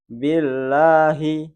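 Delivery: slow Billahi with the la held two counts; two-beat mad. Shown as a demonstration. Teacher demonstrating the two-beat length again. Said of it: "hanya dua ayunan".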